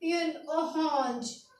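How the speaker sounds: युन ओरेंज said softly and slowly; soft slow French pronunciation. Shown as a demonstration. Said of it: "क्या बोलेंगे?".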